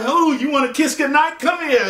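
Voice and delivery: high, playful mock eagerness; eager pouncing. Teller imagining himself on the porch with her, eager pouncing. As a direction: pretend to pounce for the kiss.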